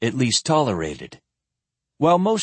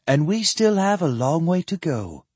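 brisk, higher-pitched woman's voice; crisp; 6 semitones higher than usual. A narrator's reading. Woman reminding the men of the journey ahead, crisply. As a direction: press the point, businesslike. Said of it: "she said crisply".

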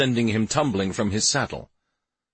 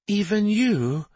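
put-on very high, slow, tearful child's question; quavering voice; 12 semitones higher, slower than usual. A narrator's reading. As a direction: quaver like a boy close to tears. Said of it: "in a quavering voice".

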